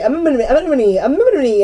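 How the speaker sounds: silly repeated quote. Retold or imitated. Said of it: "we just kept saying that shit".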